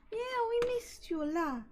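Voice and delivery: high, tiny whispered voice for a plush toy; the voice of a cuddly toy greeting a friend. Pretend. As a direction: squeak it softly like a little stuffed animal.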